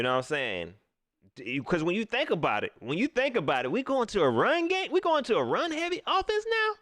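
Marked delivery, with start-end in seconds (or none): 3.7-6.7 a mocking, climbing double question; incredulous. Real, not acted. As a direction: pitch leaps high in disbelief. Mocking the run-heavy offense idea.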